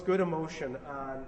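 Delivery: ordinary delivery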